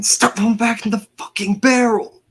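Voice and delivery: high-pitched exasperated in-character yell; yelling at Atlas. Acted. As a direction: yell it across at a companion, pitch way up. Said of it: "yelling at Atlas again".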